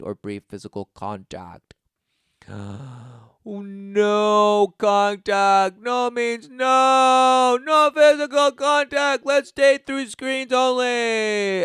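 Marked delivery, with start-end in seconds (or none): 2.5-3.2 mock gasp. Mock outrage at contact. 3.5-11.6 high, loud, slow scandalised voice; mock prude outrage.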